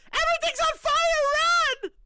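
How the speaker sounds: high, loud mock panic; panicked newsreader. Pretend anchor warning viewers of fire, high panicked yell. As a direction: yell it like the studio's burning. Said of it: "me going".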